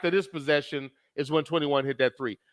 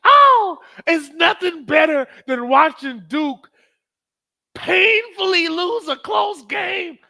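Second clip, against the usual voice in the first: much higher, loud, drawn-out gloating; gloating shout; 11 semitones higher, 7 dB louder, slower than usual. Real, not acted. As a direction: savour the rival's defeat. Gloating over Duke's loss.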